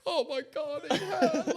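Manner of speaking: high, fussing parent voice, half laughing; hammed-up worried parent; mock parent mode. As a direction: fuss over him like a panicked parent. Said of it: "parent mode, going".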